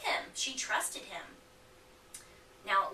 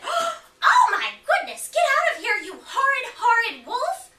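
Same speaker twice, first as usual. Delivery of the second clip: shrieking much higher and louder at the wolf; shocked granny; 14 semitones higher, 13 dB louder than usual. Acted. Granny screaming at the wolf in her bedroom, high and loud.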